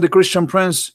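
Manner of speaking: mock challenge from the chat admin; challenging admin. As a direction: dare him to step up to the mic. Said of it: "he said".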